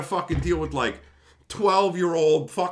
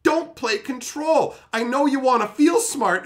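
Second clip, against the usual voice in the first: ordinary talk, nothing marked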